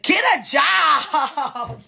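slow, drawn-out shout of get a job; shouted demand. Retold or imitated. Retelling her text to her ex, a slow shout. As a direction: yell it at him slowly, word by word.

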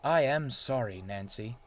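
ordinary delivery, nothing marked

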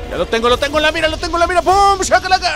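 high-pitched thrilled aiming call; excited high cheer. Real, not acted. Gamer locking onto the ghost, high excited cheering. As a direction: build to a triumphant shout.

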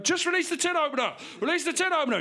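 high, projected megaphone call; megaphone negotiator. Acted. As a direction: call out orders as if through a megaphone. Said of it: "like it's a megaphone going".

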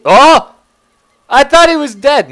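high, loud cry of alarm; real startled outburst; startled yell. Real, not acted. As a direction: yelp in shock at a sudden threat. Startled by enemy thought dead.

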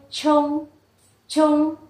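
"chung" with a puff of air on the ch, held level; "chung" slow and level, strongly aspirated onset. Shown as a demonstration. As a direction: push breath out on the first sound of "chung". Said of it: "bật hơi".

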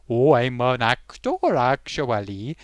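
slow, lightly Welsh "Oh, I'm an actor, actually"; a touch of Welsh lilt, drawn out; mild Welsh accent. Retold or imitated. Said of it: "In a Welsh way".

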